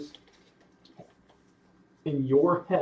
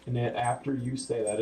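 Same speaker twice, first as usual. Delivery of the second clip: ordinary delivery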